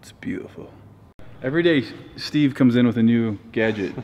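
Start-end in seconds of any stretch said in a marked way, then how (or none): 0.0-0.7 awed whisper. Real, not acted. Admiring the photo.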